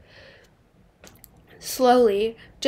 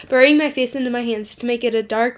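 plain reading voice

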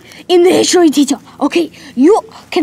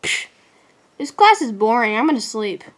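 normal talking voice

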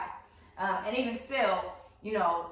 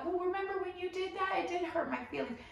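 higher, softer mock whine; whining; 9 semitones higher, 6 dB quieter than usual. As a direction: whine like you're nursing a hurt. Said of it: "whine about it all day".

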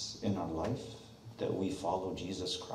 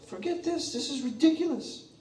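much higher, exasperated dismissal; high, whiny "forget this"; fed-up passenger; 6 dB louder than usual. Pretend. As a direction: throw it off in a huff. Passenger gives up on parachute.

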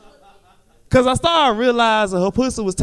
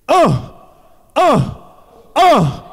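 sharp, louder grunts, like a player hitting the ball; mock tennis grunts; 6 semitones higher, 6 dB louder, much slower than usual. Comic mimicking tennis players' grunts for the crowd, sharp grunts. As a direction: grunt each one like a tennis player mid-swing. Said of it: "the only sounds you hear is tennis is".

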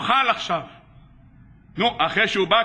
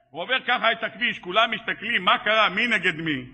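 ordinary talk

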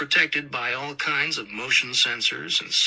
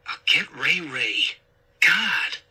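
grudging, jumping higher and louder at the end; exasperated; 3 semitones higher, 5 dB louder, slower than usual. A narrator's reading. Son agreeing to fetch his brother, exasperated. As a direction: give in, fed up.

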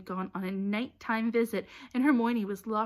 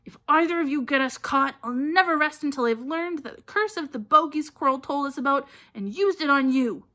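loud, high-pitched threat; heated, forceful warning; furious; 6 semitones higher, 9 dB louder than usual. A narrator's reading. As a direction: threaten them in a temper. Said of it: "glared furiously at Hermione".

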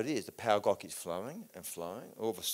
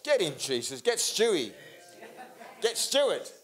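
loud and much higher, triumphant cry; shouted prayer; 7 semitones higher, 6 dB louder, slower than usual. Real, not acted. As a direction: call on Jesus at full volume. Erupting prayer call.

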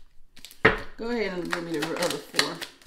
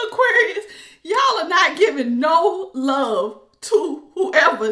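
much higher, louder, laughing and slow; 7 semitones higher, 6 dB louder, slower than usual. Real, not acted. Reader teasing Aquarius about the ex. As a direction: laugh through the words. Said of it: "I don't mean to laugh".

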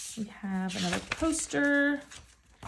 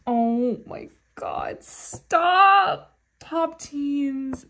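slow gasp rising to a high, loud squeal; thrilled squeal; 5 semitones higher, 7 dB louder, much slower than usual. Real, not acted. Fan finding a card she loves, slow gasp into a high, loud squeal. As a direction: let the delight burst out.

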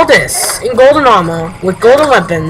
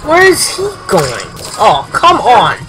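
ordinary delivery

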